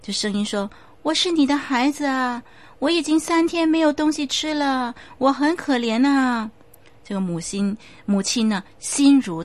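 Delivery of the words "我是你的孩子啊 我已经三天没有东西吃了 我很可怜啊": put-on higher, whining plea of a hungry child; pitiful child ghost. The ghost child pleads with his grieving mother, whining. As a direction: makes the mother pity him.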